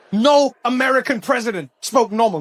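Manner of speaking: ordinary delivery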